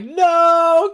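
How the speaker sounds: high, loud wailed no; hammed-up tearful outburst; mock crying. Spider-Man wailing in the rain, mock crying. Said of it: "crying in the rain".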